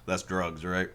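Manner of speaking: a big man stating the obvious, low; deadpan Umibozu. Retold or imitated. Umibozu voiced in recap.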